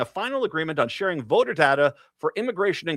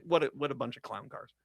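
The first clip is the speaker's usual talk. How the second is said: usual speaking voice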